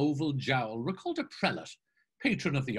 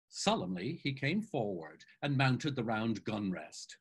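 ordinary delivery

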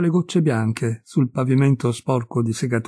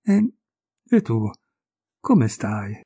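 halting, tripping over the first word; awkward stammer, caught off guard; 3 semitones lower, slower than usual. A narrator's reading. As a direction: stumble nervously into the question. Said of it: "Finalmente balbettai".

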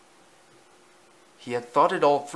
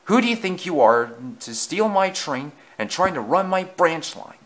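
loud, raised outrage; angry bellow; 6 semitones higher, 4 dB louder than usual. A narrator's reading. Thomas accusing Easton of stealing his train, angry bellow. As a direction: rant at full indignation. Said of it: "bellowed Thomas".

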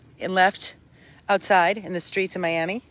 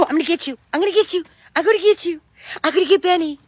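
high, louder teasing singsong; playful chase; 10 semitones higher, 4 dB louder, much faster than usual. Real, not acted. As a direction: chase the puppy, playfully threatening. Playfully chasing the puppy.

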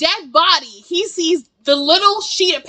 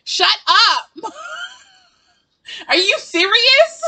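exasperated shout; 9 semitones higher, much slower than usual. Real, not acted. Yelling shut up at Cheyenne on the screen, exasperated. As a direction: yell it at the screen, fed up.

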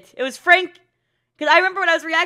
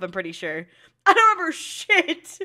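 usual speaking voice

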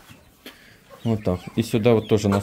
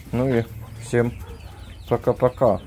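usual speaking voice

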